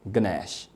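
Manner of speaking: गनैश with sh at the end, g sounded; correct pronunciation. Shown as a demonstration. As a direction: say गनैश softly. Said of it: "इसे हम गनैश पढ़ते हैं". (गनैश आखिर में श)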